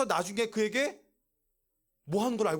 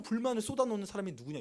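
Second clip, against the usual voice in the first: usual speaking voice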